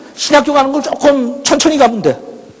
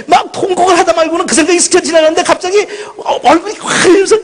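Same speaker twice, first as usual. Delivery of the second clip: high, laughing while near tears; laughing through tears; 9 semitones higher than usual. Real, not acted. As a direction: laugh and cry at once. Laughing and crying at once. (울다가 웃는 높은 목소리)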